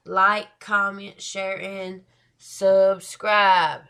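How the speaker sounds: loud slow like, comment, share, and subscribe; announcer-style sign-off plea; calling out. Real, not acted. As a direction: hammer the call to action. Channel call to action.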